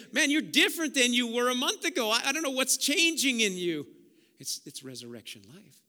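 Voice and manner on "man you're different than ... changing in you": amazed onlooker. Acted. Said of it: "People are gonna say".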